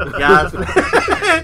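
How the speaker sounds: high, loud, laughing through the line. Pretend. Laughing at his buddy, God's plan through giggles. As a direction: giggle out the catchphrase.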